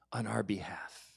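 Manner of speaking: soft solemn whisper. Real, not acted. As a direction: let it fade into a whisper. Solemn end of sacrifice point.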